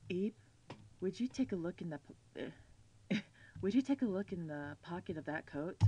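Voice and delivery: a hint of a man's voice, gently asking; attempted manly voice. Player reading game dialogue to Eve, half-hearted manly voice. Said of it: "I can't do my manly voice".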